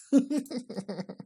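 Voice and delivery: high little chuckling laugh; real light laugh; amused giggle. Real, not acted. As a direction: giggle at the absurdity. Laughs at cartoon lookalike.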